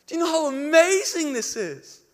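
high, loud cry of wonder; screaming out. Retold or imitated. Preacher to the crowd around the tree, crying out. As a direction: proclaim it at full voice, exultant. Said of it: "wanted to scream out".

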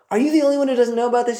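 fast, high disbelieving question; disciples' incredulous question. Retold or imitated. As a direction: blurt it out, amazed he hasn't heard. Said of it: "they say".

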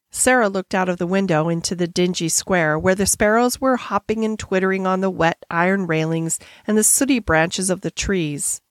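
usual speaking voice

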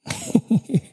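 short, high burst of laughter; amused high laugh at a grammar blunder; high laugh at herself. Real, not acted. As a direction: laugh at your own slip, lightly. Laughing at own mistakes.

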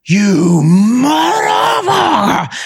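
drawn-out high yell of rage; furious shout. Retold or imitated. Driver yelling at his broken truck, drawn-out furious shout. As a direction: curse it out at full volume. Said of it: "I'm like".

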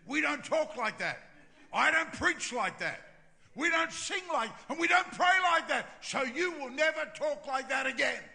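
high, very loud telling-off; scolding tirade. Retold or imitated. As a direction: build a loud, high-pitched rebuke, point by point. Going on the offensive, scolding.

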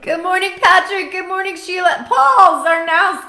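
loud, high greeting to viewers; calling out names. Real, not acted. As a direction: call out to each viewer.

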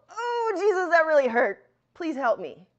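high, loud plea that eases off; acted yell of hurt, falling into a plea; pained cry out. Shown as a demonstration. Preacher acting out calling to Jesus after hurting a leg, pained cry. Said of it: "run my leg into this table and go".

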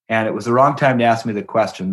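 ordinary delivery

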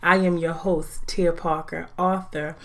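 normal talking voice

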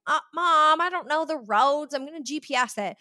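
high, loud mock-teen protest; imitated teenager fed up with a parent; exasperated teen. Acted protest of teenager to mom.

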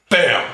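a sudden high, loud bam; imitated bam. Narrating the killing, a sudden loud bam. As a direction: blurt out the hit as a sound effect. Sound effect of the kill.

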